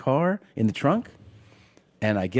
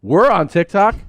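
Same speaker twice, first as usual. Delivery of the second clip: put-on drunk excited shout; 9 semitones higher, 10 dB louder than usual. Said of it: "we both were like".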